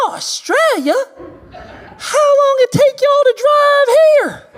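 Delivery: very high, strained Southern yokel voice. Retold or imitated. Attendant voiced in a story.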